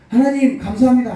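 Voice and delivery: high, loud thanks; calling out. Retold or imitated.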